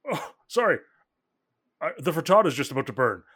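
sudden high, loud "oh" then hurried words; startled dad. Acted. Dad to family, suddenly remembering the cooking, fast. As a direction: jolt as you remember the stove.

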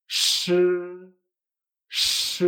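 long SH with the written i left unsaid; retroflex sh. Shown as a demonstration. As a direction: sustain SH like a shush, no "ee" vowel. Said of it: "No pronunciamos la I".

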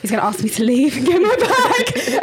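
retold panic, said high and loud through laughter; laughing through it. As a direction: laugh at your own old fear as you say it.

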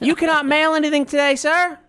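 high-pitched prissy clerk. Retold or imitated. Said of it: "story of my people".

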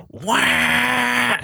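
mock duck cry; duck squawk. Duck lunging around the corner.

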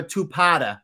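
Boston accent with the R dropped, two-pahta. Shown as a demonstration. Guest showing off hometown speech to the host, Boston accent. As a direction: play up the Boston accent on PARTER. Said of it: "she might not get that accent".

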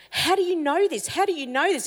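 high, astonished repeated question; excited young guy stunned by prophecy; freaking out. Retold or imitated. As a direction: pitch jumps up in disbelief. Guy freaking out over vision.

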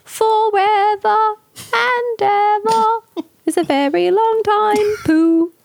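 high, slow singsong storybook voice; playful high-pitched cartoon-character quote; storybook character impression. Quoting a Winnie the Pooh film.

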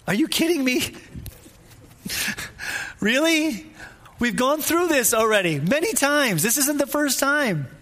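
can't believe they still don't get it; exasperated disbelief. Acted. Imagines Jesus exasperated with disciples.

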